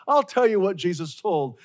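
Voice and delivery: normal talking voice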